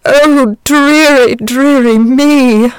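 drawn-out moan, high and loud. A narrator's reading. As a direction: wail it like a self-pitying dragon. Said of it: "moaned the dragon".

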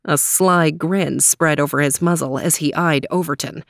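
usual speaking voice

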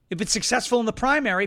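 ordinary delivery

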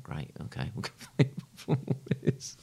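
laughing nervously through the words; a shaky, nervous laugh breaking in. Real, not acted. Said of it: "it's a nervous laugh".

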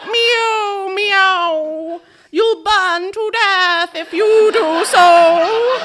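high, loud, slow cat voice. A narrator's reading. Pussycats warning Harriet not to play with matches, high cat voice. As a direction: warn her like a fretful old cat. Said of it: "the cat voice".

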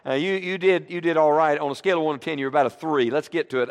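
ordinary talk

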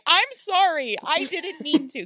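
high, loud playful apology; mock frantic apology. Viewer feeling like a bad parent, mock frantic apology. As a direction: plead as if yelled at by a child. Guilty reply to screamed accusation.

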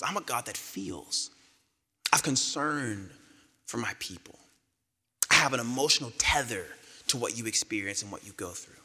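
a hint of God's tender voice. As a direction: speak it gently, as one who feels your pain. God speaks of his feelings, slow, soft.

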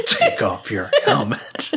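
slow, hammed-up command through laughter; teasing take on a forceful order; mock forceful. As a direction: imitate a bossy order, drawn out. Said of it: "Sounds so forceful".

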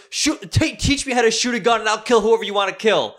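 very high, loud impression of her bragging; fired-up wannabe mobster lady. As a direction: plead wildly to join the mob, hammed up. Lady begs mob for gun.

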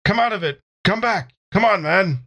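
usual speaking voice

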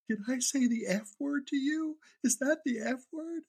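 very high, slow mock wail; drawn-out, high-pitched crying-kid voice; tearful child. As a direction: imitate a little boy in tears. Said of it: "just tears streaming down his eyes".

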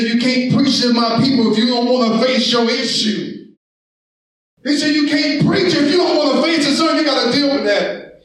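driving, urgent pulpit delivery; impassioned sermon delivery; full-force preaching. Acted. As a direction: preach it hard to the congregation.

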